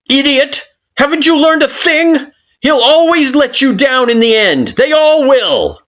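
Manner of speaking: high, loud contemptuous shout; scornful yell. Acted. Kara scorning your hope, angry shout. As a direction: spit it at them over the wind.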